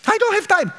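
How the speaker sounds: high, clipped refusal; busy man brushing off a friend; impatient busy man. Acted. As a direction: insist you have no time.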